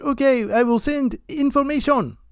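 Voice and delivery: high-pitched imitated Indian caller accent. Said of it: "eight different accents".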